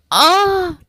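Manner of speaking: sudden high yelp as he slips; a sharp little fright cry; startled cry. A narrator's reading. Jake slipping down the mountain, yelping in fright. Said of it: "he cried".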